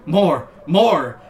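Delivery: loud hammed-up cry; mock cry out. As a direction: belt out the demand. Rock cries out in scene.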